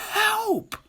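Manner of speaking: a short, piercing cry, way up high; the witch calls for help as the dragon chases her; witch cries help. Acted. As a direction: shriek for help. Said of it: "cried the witch".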